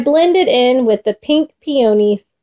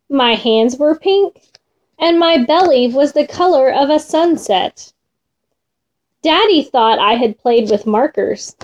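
plain reading voice

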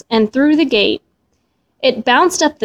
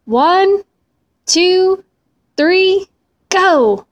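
higher, calling out each number; a boy's excited countdown to a race; yelled countdown; 6 semitones higher, much slower than usual. A narrator's reading. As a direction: shout the start of the race. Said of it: "He stood next to the pot and yelled".

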